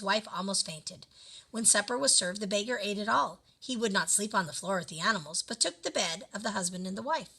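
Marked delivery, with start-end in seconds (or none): none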